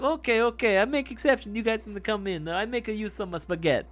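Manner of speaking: mock Italian accent, eager and high; fake Italian accent. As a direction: play a cartoon Italian chef with a mock Italian accent. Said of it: "he had the fake Italian accent too".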